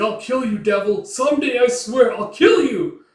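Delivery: high, loud shouted threat; acted enraged yelling; furious vow. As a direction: swear revenge at the top of the voice. Teresia vows revenge on Guts.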